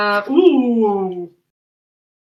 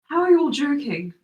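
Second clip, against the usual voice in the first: lightly voiced distressed Loran. Shaken Loran questions the joking party, mildly distressed. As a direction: plead in mild disbelief, settling toward normal.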